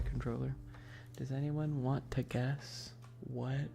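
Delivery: ordinary talk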